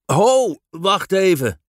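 put-on a father reining in his children; calling to stop. Father stops running girls. (luid en hoog roepen om te stoppen)

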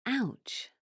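high, very soft ouch; a small hurt ouch after a fall; pained ouch. Acted. Guy landed in flowerbed.

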